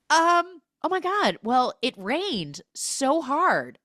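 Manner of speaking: high, loud stalling, then drawn out; mock flustered deflection. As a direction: scramble for small talk in hammed-up panic. Retold flustered woman deflecting the question.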